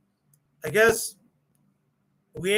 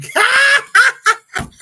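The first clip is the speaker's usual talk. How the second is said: shrieking burst of laughter; loud high laugh; 10 dB louder than usual. Real, not acted. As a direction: cackle out loud. Laughing at the theme song.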